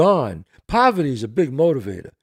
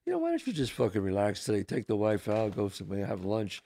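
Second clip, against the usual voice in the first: tempting inner voice; 7 semitones lower, 10 dB quieter than usual. Retold or imitated. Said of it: "I gotta wake up and go".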